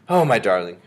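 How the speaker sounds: loud, fond exclamation; doting grandmother's outburst; relieved cry. A narrator's reading. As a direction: cry out with affection. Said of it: "she cried".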